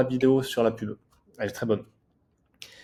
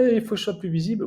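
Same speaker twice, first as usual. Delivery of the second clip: eager mock complaint; 8 semitones higher, faster than usual. Imitating people's eager complaint.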